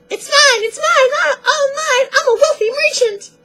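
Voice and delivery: a hammed-up cartoon scream of greed. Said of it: "he's screaming".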